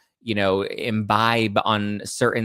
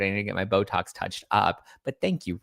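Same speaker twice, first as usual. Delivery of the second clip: ordinary delivery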